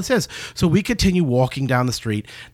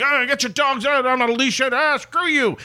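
mild mock yelling; 8 semitones higher than usual. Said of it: "A little yelling like".

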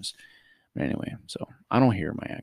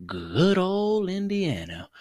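high, drawn-out fond fanfare; mock grand announcement; 11 semitones higher, much slower than usual. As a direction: stretch it slowly like a show intro. Grand introduction of next state.

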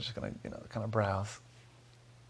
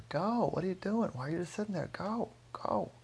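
high-pitched mock shouting, held at normal volume; mock yell; 9 semitones higher than usual. Driver yelling out the window at a stopped car, mock yell. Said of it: "started yelling out the window".

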